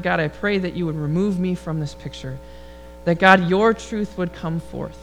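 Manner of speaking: normal talking voice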